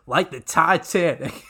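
punchy answering hook, lightly mimicked; mock rapper's punchline reply; imitating call-and-response answer. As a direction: toss it back like the song's response. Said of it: "you hear one side and he goes".

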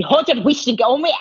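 mock Scottish scolding, rushed out in one breath; Scottish mum. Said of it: "my mum, who's Scottish, always, uh, would say to me".

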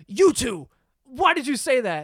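imitated teacher's telling-off; accusing teacher. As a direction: confront two students as an angry teacher. Teacher accuses two students.

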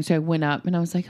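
usual speaking voice

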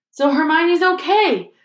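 light up with relief; brightly. Acted. Ron hearing Hermione will recover, bright. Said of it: "said Ron brightly".

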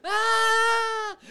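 long high laughing fit; real laughter after the punchline. Real, not acted. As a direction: dissolve into laughter. Teller laughing.

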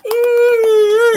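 high wailing ooh; mock cry. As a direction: let out a high sobbing ooh. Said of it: "I'm just going".

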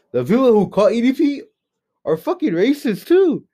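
can't believe what he's saying; incredulous outburst. Real, not acted. Shocked reveal, voice shoots up.

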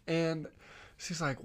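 normal talking voice

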